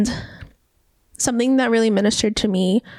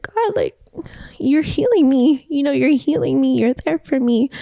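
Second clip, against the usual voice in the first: higher voice, marvelling to God; retold heartfelt awe; 4 semitones higher than usual. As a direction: address God in raised-pitch wonder.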